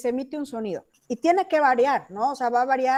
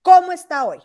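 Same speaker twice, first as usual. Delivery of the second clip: "Cómo está hoy" higher and louder, falling at the end; demonstrated question intonation; 5 semitones higher, 9 dB louder than usual. Shown as a demonstration. Teacher echoing a student's question to show tone, lifted intonation. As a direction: lift "Cómo está hoy" high, then drop. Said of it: "Ese es el tono".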